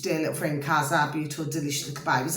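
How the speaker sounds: soft doting aside to a dear friend; cooing pet names. Real, not acted. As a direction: dote on them quietly.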